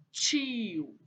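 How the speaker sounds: "tio" stretched long with a falling glide; slow, elongated "tiiio"; drawn-out word. Shown as a demonstration. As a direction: reveal "tio" slowly, holding the vowels. Teacher sounds out the answer.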